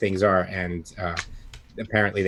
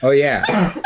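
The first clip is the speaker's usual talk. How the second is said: laughing; 10 semitones higher, 4 dB louder than usual. Acted. Character agrees, laughing.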